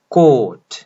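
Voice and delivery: कोट said loud with a long open o and a crisp t; model pronunciation. Shown as a demonstration. Teacher modelling the word for students, loud and clear. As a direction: give कोट firmly, stretching the long vowel. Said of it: "C A यू जी H T को pronounce करें".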